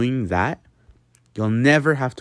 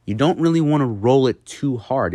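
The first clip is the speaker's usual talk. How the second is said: ordinary talk, nothing marked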